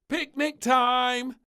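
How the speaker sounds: very loud, high, drawn-out shout; bellowing. A narrator's reading. Princess startles the chef and maid, loud slow bellow. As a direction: bellow it across the kitchen. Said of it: "bellowed the little princess".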